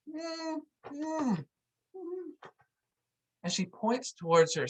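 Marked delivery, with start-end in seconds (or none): 0.1-2.2 high, soft, pinched little hums through closed lips; panicked humming. Retold or imitated. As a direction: whimper mm through tight lips, mouth shut. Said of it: "And she goes".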